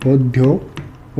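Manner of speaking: उद्योग drawn out very slowly, syllable by syllable; slow dictation. Shown as a demonstration. Teacher to class, dictating the word very slowly. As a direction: stretch उद्योग out slowly so students can write it.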